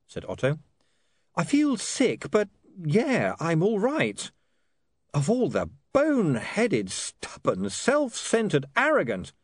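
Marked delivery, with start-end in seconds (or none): 1.3-9.3 lightly voiced young man, exasperated; shaken ranting son. William to Otto, about his father, lightly exasperated. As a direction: vent about your father while still shaky.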